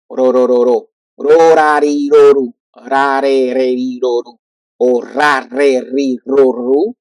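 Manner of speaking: chanting R syllables one by one; syllable drill. Shown as a demonstration. Teacher drilling R syllables for students. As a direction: drill ro ra ri re ru clearly. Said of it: "syllable drill".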